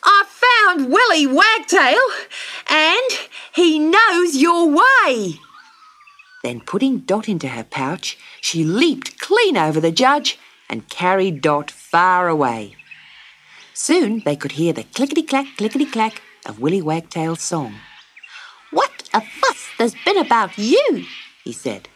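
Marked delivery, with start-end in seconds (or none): 0.0-5.4 high, slow, excited kangaroo; breathless, eager and slow animal voice. A narrator's reading. 18.7-21.0 little bird voice. A narrator's reading. Bird character talks.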